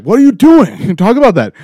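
high, loud, laughing disbelief; exasperated yell. Retold or imitated. Scolding his past self, a laughing exasperated yell. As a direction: yell at yourself half laughing. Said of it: "I'd be like".

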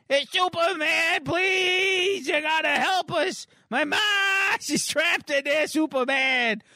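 high-pitched desperate cry for help; Mitch. Acted. Boy begs Superman to save trapped ma, high frantic cry. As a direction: squeal it out like a panicked kid. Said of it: "She's crying out to Mitch, and Mitch, she's".

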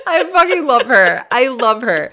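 high, loud gush through laughter; laughing delight. Real, not acted. Cracking up over a listener's texts, laughing delight. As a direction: crack up while declaring love.

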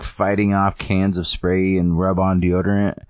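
normal talking voice

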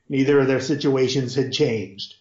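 usual speaking voice

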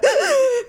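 sharp high gasping sob; showing the movie-crying sob, gasping high. Shown as a demonstration. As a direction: demonstrate the sob you hold in. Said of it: "the sob that we do".

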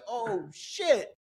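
sudden high yelp of surprise; high-pitched startled curse; startled oh shit. Retold or imitated. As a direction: blurt it out high, caught off guard. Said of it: "I was like".

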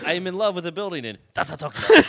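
put-on Japanese accent. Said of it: "you were talking in an accent".

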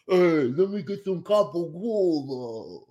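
slow, hammed-up Italian-American guido voice; mock a fake Italian-American tough guy; drawn-out mock Jersey Italian accent. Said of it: "no Italian really talks like that".

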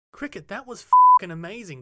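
loud, high Trump voice; Donald Trump impression. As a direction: boom it out like Trump. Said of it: "the most amazing Donald Trump impersonation".

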